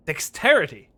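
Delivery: loud, high indignant yelling; exasperated outburst. Real, not acted. Player yelling at the game about dexterity, loud and exasperated. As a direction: blow up at the game's demand.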